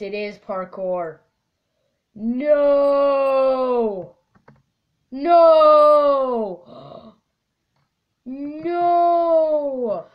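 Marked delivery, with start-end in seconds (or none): 2.1-10.1 loud despairing shouts; shouting no. Real, not acted. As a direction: yell it out in dismay. Despair at parkour map.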